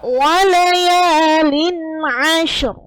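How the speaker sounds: "walayalin ashr" drawn out slowly; slow recitation. Shown as a demonstration. Tajwid teacher reading the next verse, slow recitation. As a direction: stretch "walayalin" long, then a clean "ashr". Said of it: "Sekarang yang kedua".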